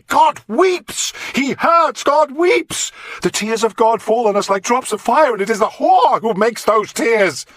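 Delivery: high, loud, frenzied preaching; raving. Acted. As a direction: rant like a possessed prophet. Mad monk raving at the king.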